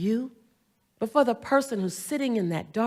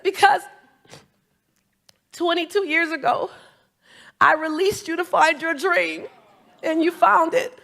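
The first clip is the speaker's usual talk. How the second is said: higher, moved retelling of the boss; tearful former boss; 7 semitones higher, 4 dB louder than usual. Retold or imitated. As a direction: let the old boss's voice rise with emotion. Fired boss, tearful, retold.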